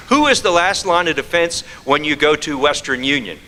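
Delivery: ordinary talk, nothing marked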